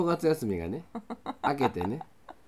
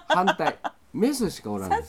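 high laughing blurt of メスしかおらんです; メスしかおらんです said high through laughter; 9 semitones higher, 5 dB louder than usual. Real, not acted. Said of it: "違う違う違う".

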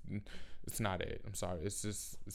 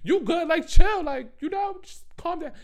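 mock calming down; 8 dB louder than usual. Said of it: "I'm like".

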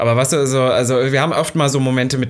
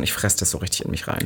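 whispered; 5 semitones lower, 8 dB quieter than usual. Real, not acted. Confessing bitterness, unvoiced.

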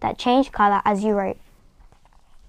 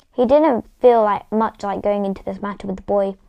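ordinary delivery, nothing marked

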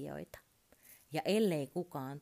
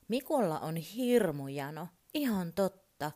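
usual speaking voice

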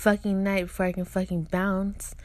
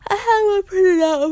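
crying; 10 semitones higher, 7 dB louder than usual. Real, not acted. Sudden voice break, crying sound.

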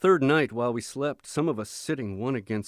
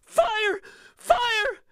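shrill desperate shrieks; screaming; 6 dB louder than usual. A narrator's reading. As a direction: scream over and over. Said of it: "still her screams went on".

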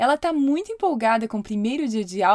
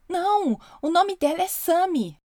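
shouting; 7 semitones higher than usual. A narrator's reading. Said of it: "Então eu gritei".

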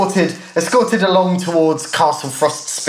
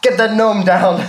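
put-on American guard accent; 5 semitones higher, 4 dB louder than usual. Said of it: "He says".

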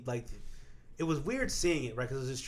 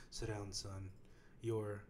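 lower, much softer grave father voice; somber movie dad; 6 semitones lower, 14 dB quieter than usual. Pretend. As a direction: play the hushed film father breaking bad news. Mocking a somber movie scene.

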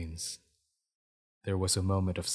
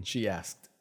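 plain reading voice

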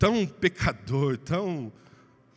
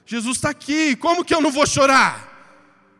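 crying wife; 9 semitones higher, 7 dB louder than usual. Retold or imitated. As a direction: shout it through tears. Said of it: "E ela chorava". (esposa chorando, gritando devagar e emocionada)

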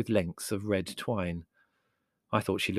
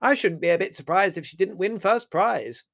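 high, loud, amused piping; chuckling dotty aunt; 11 semitones higher, 7 dB louder than usual. A narrator's reading. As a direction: say it with tickled delight.

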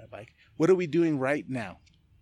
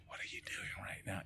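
"What are you doing right now?" whispered, much softer; whispered self-reminder; 4 semitones lower, 15 dB quieter than usual. Retold or imitated. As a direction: whisper it to yourself to refocus. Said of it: "I'm just like".

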